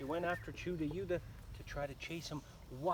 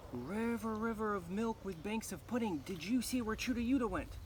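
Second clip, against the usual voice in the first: put-on urgent girl; 6 semitones higher than usual. A narrator's reading. Girl asks river.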